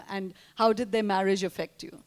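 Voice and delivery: ordinary talk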